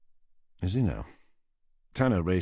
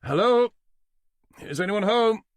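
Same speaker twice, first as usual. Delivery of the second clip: loud, high call toward a closed boat; 14 semitones higher, 7 dB louder than usual. Acted. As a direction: call out to whoever is inside. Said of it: "Tanner was forced to call out".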